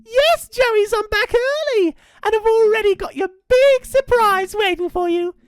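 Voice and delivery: sweet high voice. Acted. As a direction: fake a sweet mum calling. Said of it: "in his now sweet and high voice".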